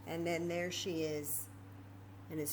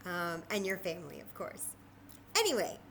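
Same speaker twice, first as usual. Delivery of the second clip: ordinary delivery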